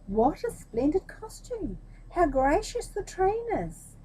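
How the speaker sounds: slow, high admiring cries; crowd crying out. Acted. Street crowd praising the emperor's costume, high slow cries.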